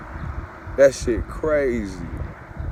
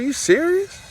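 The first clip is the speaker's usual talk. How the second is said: high, incredulous question; ex-wife doubting the news, high and incredulous; disbelieving ex. Retold or imitated. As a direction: ask it like you can't believe your ears. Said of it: "She said".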